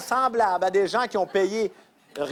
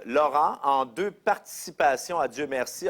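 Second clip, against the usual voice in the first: ordinary talk, nothing marked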